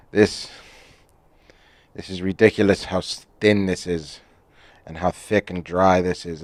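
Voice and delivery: put-on English accent. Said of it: "in an English accent".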